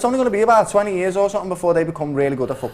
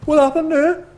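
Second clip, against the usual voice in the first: shocked fans; 12 semitones higher than usual. Retold or imitated. Fans watching England concede, high shocked cry. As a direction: act the stunned fan. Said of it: "everyone going".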